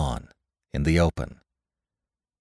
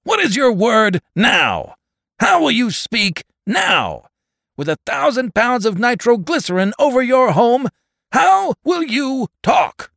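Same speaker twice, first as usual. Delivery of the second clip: very high, loud, hammering demand; iron-fisted shout; 7 dB louder than usual. A narrator's reading. Voice from the sky demands his word now, iron-fisted shout. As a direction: bear down hard from the sky, shouting each question. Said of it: "The voice from the sky fell on him like an iron fist".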